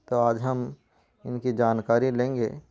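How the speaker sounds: ordinary talk, nothing marked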